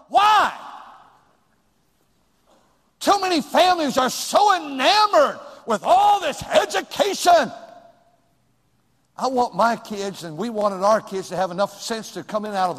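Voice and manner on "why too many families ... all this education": high, loud preaching cry; preaching shout. Real, not acted. Preacher scolding families over education, loud high shout. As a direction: throw it out to the whole church at full force. Preacher rising to full force.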